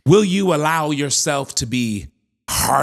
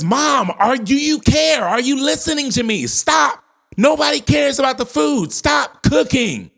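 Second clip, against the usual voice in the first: furious high, loud shout; blowing up; 12 semitones higher, 4 dB louder than usual. Acted. Fed-up daughter blows up at her mother, angry shouting. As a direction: explode at mom, voice pitched way up. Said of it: "the girl blows up at her mother".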